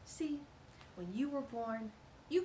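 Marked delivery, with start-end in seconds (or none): none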